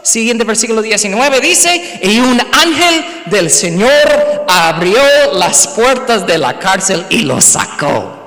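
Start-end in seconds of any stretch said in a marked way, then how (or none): 2.0-8.2 slow, solemn reading of the verse; scripture reading. Acted. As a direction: read the verse out slowly, weighty. Reads the verse aloud.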